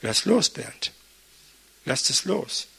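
soft, gentle letting-go advice; quiet advice. Retold or imitated. Preacher retelling advice given to him, said softly. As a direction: say it softly, like calming a friend. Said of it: "Und die Lösung war".

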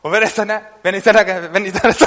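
normal talking voice

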